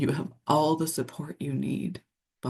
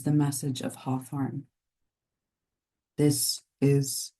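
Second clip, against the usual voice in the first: guided meditation. Acted. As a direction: lull listeners into the unseen realm of nature. Delivers a channeled nature message.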